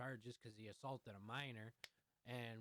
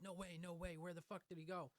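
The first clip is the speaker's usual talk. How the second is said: mild acted panic in a higher voice; freaking out; 5 semitones higher than usual. Teller quotes himself finding the man gone, mildly freaking out. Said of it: "I'm freaking out".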